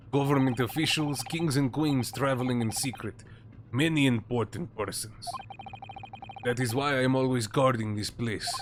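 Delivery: put-on proud, stiff broken-English Russian accent; Russian-accented sailor. Sailor correcting the lawyer, proud Russian accent. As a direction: insist on his dignity in a Russian accent.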